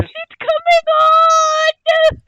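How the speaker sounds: slow, piercing fake sob-shout; hammed-up crying tantrum at full volume; mock tantrum wail. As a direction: wail like a child pitching a fit. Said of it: "howl and scream and throw myself".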